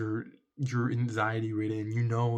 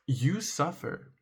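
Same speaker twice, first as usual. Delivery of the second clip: ordinary talk, nothing marked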